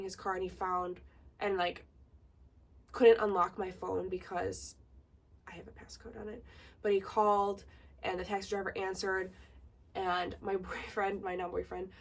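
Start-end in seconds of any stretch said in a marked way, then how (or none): 5.5-6.4 breathy whisper tossed off; whispered aside. Real, not acted. Explaining to viewers why the driver couldn't unlock it, whispered. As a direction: drop to a whisper for the side note.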